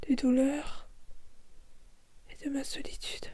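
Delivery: soft unvoiced whisper dying out; breathy fading whisper. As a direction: vanish into emptiness. Fading away into nothing.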